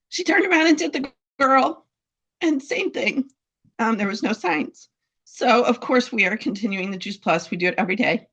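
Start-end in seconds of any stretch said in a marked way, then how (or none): 0.1-3.3 fighting back tears, voice breaking; choked up. Real, not acted. Owner telling of her dog's recovery, tearfully. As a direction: voice rising high as tears come. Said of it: "Sorry, it's emotional".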